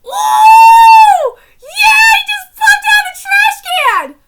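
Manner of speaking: high, loud hype yell; excited shout. Real, not acted. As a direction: shout it like you can't believe it. Reacting live to anime fight.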